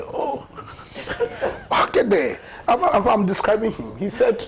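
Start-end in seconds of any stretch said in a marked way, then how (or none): 0.1-2.4 brushing off a question through laughter; laughing. Real, not acted. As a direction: laugh as you promise to explain. Laughing off the co-host's request.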